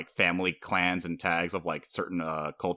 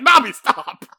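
high, louder laughing protest; half-laughing plea to make her stop; high, loud mock plea, laughing between words; mock scolding; 8 dB louder than usual. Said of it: "And I was like".

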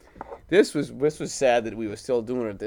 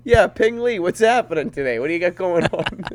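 nephew's friendly call, pitched up and louder; imitating nephew; 4 semitones higher, 9 dB louder than usual. Uncle imitating his nephew on the phone, high and loud. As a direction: play the nephew chatting up his buddy, high. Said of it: "He'll just call 'em up".

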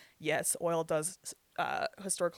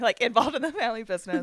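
laughing through the words, louder; 6 semitones higher, 9 dB louder than usual. Real, not acted. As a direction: giggle out the jab.

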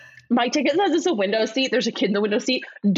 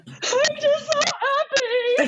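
high, slow sob-choked happy; imitating her own sobbing happiness; mock sobbing; much slower than usual. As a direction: squeeze it out high and slow through tears. Quoting herself mid breakdown.

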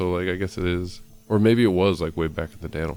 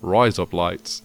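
louder, punchy Aussie demo; mock Australian accent; 7 dB louder, much slower than usual. Shown as a demonstration. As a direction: say it firmly as if Australian. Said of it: "razor blades sounding Australian, you would say".

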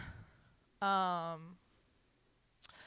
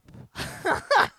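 loud, high burst of real laughter; exasperated laugh; 13 semitones higher, 13 dB louder than usual. Real, not acted. Woman laughing at her own rant about the neighbour, exasperated.

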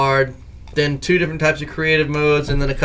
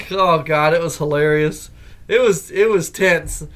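usual speaking voice

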